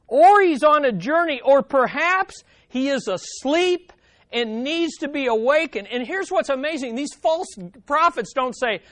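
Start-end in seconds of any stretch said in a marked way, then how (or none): 0.1-5.9 loud, high mocking call; mocking taunt. Elijah taunting the prophets, loud and high. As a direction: jeer at them, voice raised high. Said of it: "Elijah mocks them and says".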